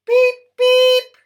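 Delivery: very high, very loud beeping; engine whistles. A narrator's reading. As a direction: toot like cheerful engines.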